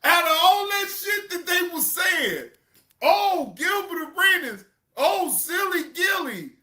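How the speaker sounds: high, loud mocking cheer; laughing shout. Real, not acted. Streamer mocking fans who now praise Gilbert, hollering.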